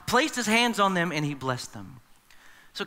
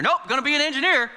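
higher, louder flat refusal; dismissive parent, cutting it off; stubborn parent; 8 semitones higher, 4 dB louder, faster than usual. Retold or imitated. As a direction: shut the idea down with a stubborn, raised voice. Stubborn parent in acted quarrel.

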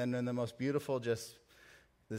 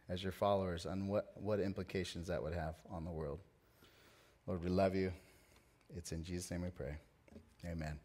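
hushed pastor's prayer before the sermon; 6 semitones lower, 5 dB quieter than usual. Acted. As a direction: pray quietly and earnestly. Leads prayer.

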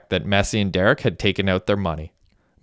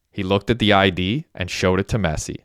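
plain reading voice

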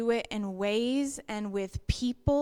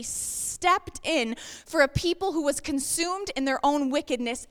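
rising, louder impassioned preaching; preaching at full force; 4 semitones higher, 6 dB louder than usual. Real, not acted. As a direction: build the sermon to a climax.

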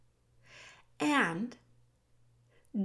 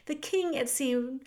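ordinary delivery

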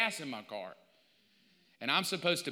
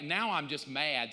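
usual speaking voice

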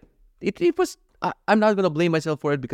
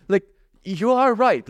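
raised, higher-pitched retold rant; 5 semitones higher than usual. Calling brother-in-law about electric cars, worked-up rant. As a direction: rant it out in a run of complaints. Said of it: "I start ranting".

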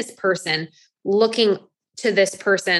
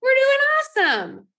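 much higher, bright boastful cheer; proud cheer; 8 semitones higher than usual. Retold or imitated. Coach voicing a proud inner thought, high and cheerful. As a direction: chirp it like you nailed the day. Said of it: "but in, like".

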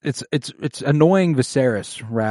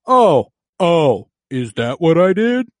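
mock Viserys; 6 semitones higher, 7 dB louder, slower than usual. Acted quarrel, Viserys voiced.

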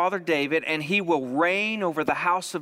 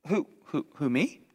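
lower, halting, mildly startled stammer; flustered stammer; 3 semitones lower, 4 dB quieter than usual. Acted. Young Mary interrupting the angel, flustered. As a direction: stammer in mock disbelief.

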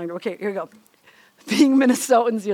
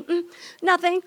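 higher-pitched, lightly acted denial; 6 semitones higher than usual. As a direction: imitate a wife pretending nothing is wrong. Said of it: "I just kind of quietly do".

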